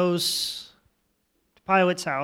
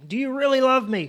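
raised, pressing acted question; retold plea, pitched up; insistent question; 7 semitones higher, 4 dB louder than usual. Voices Jesus asking Peter again.

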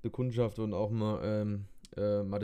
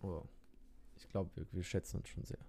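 whispering; 4 semitones lower, 11 dB quieter than usual. Real, not acted. Mock-sentimental exchange.

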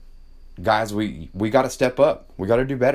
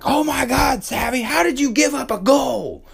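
high-pitched, exasperated parental outburst; hammed-up dismay, pitched way up; mock overreaction. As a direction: play the too-tough parent, blowing it out of proportion. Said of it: "being the wrong thing would have been like".

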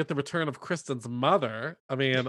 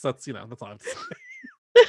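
mock-grand finish; 4 dB louder than usual. Comic mic-drop declaration.

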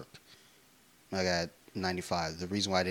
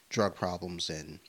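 ordinary talk, nothing marked

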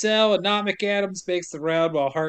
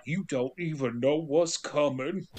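ominous prisoner; 4 semitones lower, 6 dB quieter than usual. Retold or imitated. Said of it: "being like".

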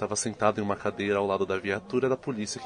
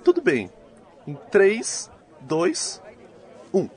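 loud countdown; 9 semitones higher, 7 dB louder, much slower than usual. Acted. Cameraman counts down recording.

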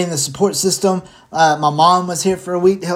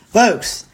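sigh; 6 semitones higher, 3 dB louder than usual. Real, not acted. Sigh before sign-off.

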